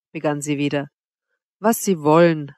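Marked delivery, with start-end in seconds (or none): none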